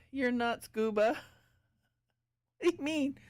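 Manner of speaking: usual speaking voice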